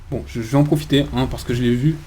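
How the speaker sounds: usual speaking voice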